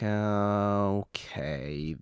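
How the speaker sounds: long drawn-out, level okay; slow, dubious okay; baffled acceptance. Acted. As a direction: stretch it out as if slowly taking it in. Acts out baffled viewer reaction.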